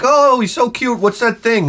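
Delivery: high, sweet neighbor voice; mock neighbor. Neighbor cooing over the dog in a wheelchair, high sweet voice. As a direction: coo like a nosy neighbor. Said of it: "the neighbor's like".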